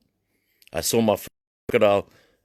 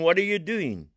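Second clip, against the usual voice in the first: haughty, scornful question; arrogant; 11 semitones higher than usual. Retold or imitated. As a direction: demand it with arrogance. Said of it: "In an arrogant way".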